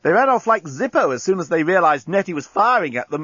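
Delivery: high-pitched, lively excitement; enthusiastic. A narrator's reading. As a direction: burst out with eager enthusiasm. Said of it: "exclaimed Inchby Wiglet enthusiastically".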